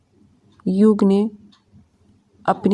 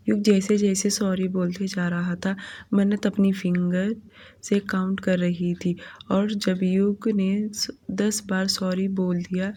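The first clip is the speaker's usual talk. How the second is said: ordinary delivery, nothing marked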